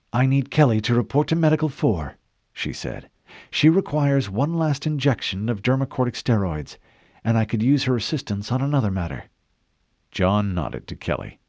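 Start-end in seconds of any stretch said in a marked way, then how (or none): 0.1-2.2 higher-pitched doctor's voice, businesslike; a hint of a doctor making a request; lightly voiced doctor. 3.5-9.3 higher-pitched doctor's voice, businesslike; a hint of a doctor making a request; lightly voiced doctor.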